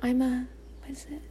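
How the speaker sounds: whispered ASMR chat; playful whispered small talk. Pretend. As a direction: keep it to a hushed whisper. Playing ASMR whispering game.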